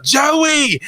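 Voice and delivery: high-pitched acted scream of a name. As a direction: cry out the name, high and desperate. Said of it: "screaming out".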